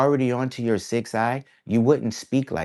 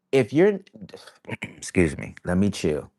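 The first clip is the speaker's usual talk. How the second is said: ordinary delivery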